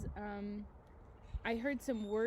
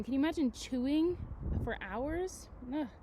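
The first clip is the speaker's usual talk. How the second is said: normal talking voice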